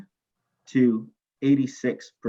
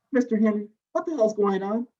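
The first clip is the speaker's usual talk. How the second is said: calling out; 8 semitones higher than usual. Retold or imitated. TA calls out to angry student.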